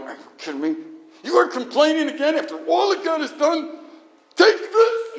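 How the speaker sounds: acted angry shout building to a scream; furious Moses. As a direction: Moses blowing up at the complaining people. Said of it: "Little dramatic presentation".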